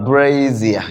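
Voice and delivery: "brazier" very slow and low, BRAAAY-zee-uh; correct pronunciation. Shown as a demonstration. Teacher drills the class, slow correct pronunciation. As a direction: draw out "brazier" with a buzzed z, falling. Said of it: "It's brazier".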